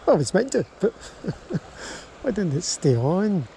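ordinary talk

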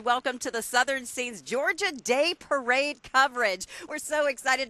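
upbeat broadcast host opening; parade commentator. Real, not acted. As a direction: welcome viewers like a live TV host.

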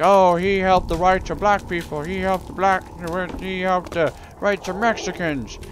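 sing-song, preachy mock praise; mocking sanctimonious voice. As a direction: parody smug do-gooders. Mocking people praising others.

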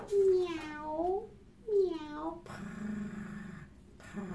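high mewing meows, then soft purring; cat meows and purrs. Pretend. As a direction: play a prissy cat saying hello. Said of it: "A cat hello is prissy and proud, like this".

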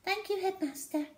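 muffled voice. A narrator's reading. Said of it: "came a muffled voice".

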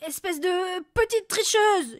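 loud, slow, high furious yell; sudden yell. A narrator's reading. Furious father yells at his daughter, loud slow shout. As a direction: hurl the insult at his daughter. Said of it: "hurla brusquement le père".

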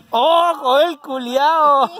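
loud, high, delighted exclamation; excited shout. Real, not acted. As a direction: yell it out at the screen. Shouts at the replayed play.

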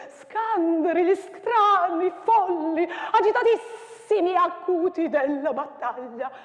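declaimed with wild swings of pitch and loudness; dramatic poem recital. Acted. Teacher performing the battle poem for students, declaimed.

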